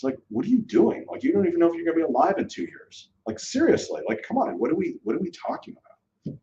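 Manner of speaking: exasperated, pitch climbing high; incredulous outburst on a soapbox; soapbox rant. Real, not acted. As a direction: rant like you can't believe it. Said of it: "that's me on a soapbox".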